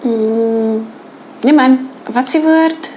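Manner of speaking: playfully groping for a word, switching to Afrikaans; a staged stumble, searching for the word in Afrikaans; mock word search in Afrikaans. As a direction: act out losing the English word, muttering in Afrikaans. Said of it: "I do make mistakes and I do stumble sometimes".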